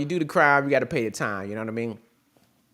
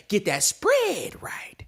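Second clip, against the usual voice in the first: whisper; 5 semitones higher, much slower than usual. Acted. Whispered tip.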